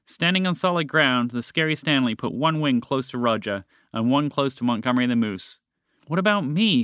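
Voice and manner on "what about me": higher-pitched, put-out complaint; huffy. A narrator's reading. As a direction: huff it, feeling left out. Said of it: "Fred huffed".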